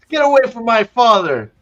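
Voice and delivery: high, loud yell dropping at the end; hammed-up angry shout. Matt stabs the man threatening his father, mock furious shout. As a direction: yell it as you lunge. Replaying Matt's attack.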